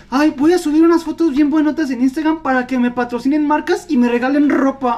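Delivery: lightly voiced airheaded influencer; mocking influencer voice. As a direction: play a spoiled influencer, lightly. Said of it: "voy a hacer el papel de Dani".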